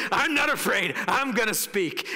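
acted defiance. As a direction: stand up boldly to a mob. Acting out Paul defying the mob.

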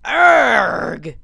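loud effortful arrgh; frustrated roar. Real, not acted. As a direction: shout it out as you strain. Straining at a hard jump.

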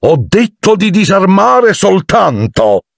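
high, slow alarmed cry; alarmed shout. A narrator's reading. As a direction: scold in a panic. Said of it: "gridò Lockhart allarmato". (grido allarmato e acuto)

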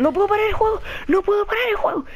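high-pitched frantic wail; panicked. Real, not acted. Player unable to stop the game, panicked. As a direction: squeal it twice, helpless. Cannot pause during panic.

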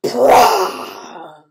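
loud, low blurted fart sound; mock fart noise. A thunderous fart for the animals. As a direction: blow out a big comic fart noise.

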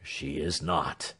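low, soft and slow; grave man telling sad news; sorrowful denial. A narrator's reading. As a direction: break the news gently. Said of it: "shaking his head".

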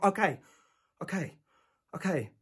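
soft, subdued surrender; backing down. Acted. Gives in to nurse's threat.

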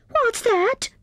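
very high, startled question; startled cry. A narrator's reading. Squirrel hears a voice from the snowball, startled cry. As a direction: jump at a strange noise. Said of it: "cried Squirrel, startled".

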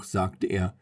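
usual speaking voice

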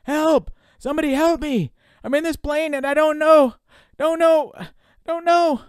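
loud, very high cries for help; terrified, high-pitched yelling; panicked screaming. Acted. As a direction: scream desperately into the microphone. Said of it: "he began to scream into the microphone".

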